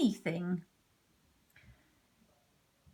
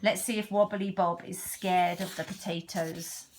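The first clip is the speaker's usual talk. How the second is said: plain reading voice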